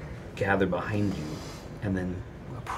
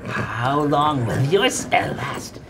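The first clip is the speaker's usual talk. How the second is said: creepy monster taunt; 5 semitones higher, slower than usual. Acted.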